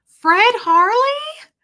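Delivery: high, loud, drawn-out questioning call; old lady calling out. Retold or imitated. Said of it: "hear an old lady in the background".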